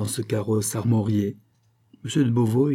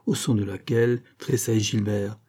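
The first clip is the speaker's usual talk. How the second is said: ordinary delivery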